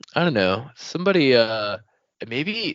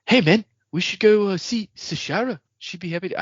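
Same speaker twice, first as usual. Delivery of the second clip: put-on Taika Waititi New Zealand accent; 6 semitones higher than usual. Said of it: "do, like, a serious New Zealand accent".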